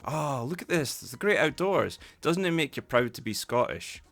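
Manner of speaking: high, gushing admiration of the view; acted enthusiasm. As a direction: rouse your unwilling friends with loud, lifted pride. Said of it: "One guy is, but the rest".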